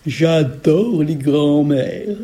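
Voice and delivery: very slow, savouring ogress; muttering ogress. A narrator's reading. Ogress at grandmother's door, slow greedy mutter. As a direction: relish each word slowly like a hungry ogress. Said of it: "marmonne Logresse".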